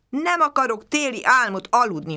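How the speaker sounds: a sulky cub digging in his heels, stressing Nem; grumbling. A narrator's reading. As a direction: bear cub refusing to hibernate, grumbling loudly. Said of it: "morogta pocak".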